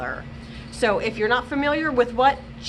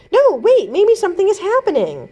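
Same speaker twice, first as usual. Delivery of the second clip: higher, louder, urgent; excited call-out; 8 semitones higher, 8 dB louder than usual. Acted. Cam insisting to Eric that something is happening, excited and loud. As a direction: cry out that something's up.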